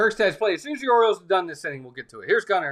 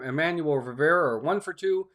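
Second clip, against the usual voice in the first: normal talking voice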